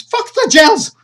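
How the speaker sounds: screamed very high and very loud; furious scream. Acted. She screams at Sergei to forget the gels, in fury. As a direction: let rip in fury. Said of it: "she goes".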